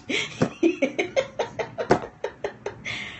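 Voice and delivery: long, high, airy laugh. Real, not acted. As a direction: let a voiceless giggle run on. Laughs to herself after warning.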